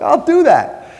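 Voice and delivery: hammed-up passive-aggressive yes; passive-aggressive promise. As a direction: chirp it like you'll never actually do it. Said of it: "Mister Passive Aggressive".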